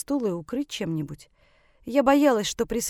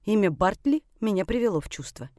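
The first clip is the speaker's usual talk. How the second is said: plain reading voice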